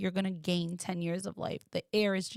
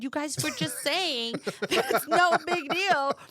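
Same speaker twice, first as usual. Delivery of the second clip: higher, louder, half-laughing disbelief; exasperated demand; 8 semitones higher, 5 dB louder than usual. Retold or imitated. Begging her relatives to take her back, loud and exasperated. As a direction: insist through a nervous laugh. Demanding to leave amid bombing.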